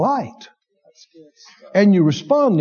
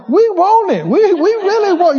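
whiny flesh; 9 semitones higher, 4 dB louder than usual. Retold or imitated. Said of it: "My flesh is going".

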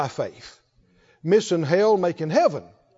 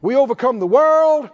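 rising, slower litany, each line ending on faith; fervent preaching; 5 semitones higher, 3 dB louder, slower than usual. Acted. Preacher rousing the saints with a faith litany, fervent. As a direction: build the list like a revival preacher.

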